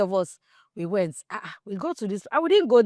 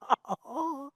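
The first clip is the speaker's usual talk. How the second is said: soft real laugh; 6 semitones higher, 8 dB quieter than usual. Real, not acted. Laughs after the story.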